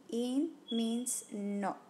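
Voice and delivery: drawn-out, each word weighted; slow teaching emphasis; slow emphatic rule. Shown as a demonstration. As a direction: spell it out slowly for the student.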